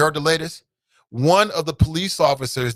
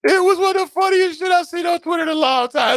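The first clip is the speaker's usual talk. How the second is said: shrieking laugh; 14 semitones higher than usual. Real, not acted. Vlogger howling over the funniest tweets, high laughing shout. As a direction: squeal it out with delight.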